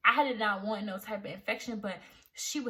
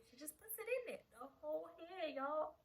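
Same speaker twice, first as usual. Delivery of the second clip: soft, higher, appalled aside; squeamish hush; 5 semitones higher, 14 dB quieter than usual. Real, not acted. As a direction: confide the graphic detail softly. Squeamish telling of graphic detail.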